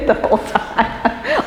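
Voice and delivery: giggling through the words; laughing. Real, not acted. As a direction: laugh while you say it. Laughs at husband's moves.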